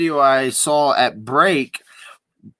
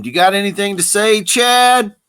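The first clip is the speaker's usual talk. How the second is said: raised, higher-pitched called-out question; imitating a yell; 8 semitones higher, 5 dB louder than usual. Reporter yelling out to Chad in the retelling, mock yell. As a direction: call it out to someone, voice jumping up on the name. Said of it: "he yells out to him".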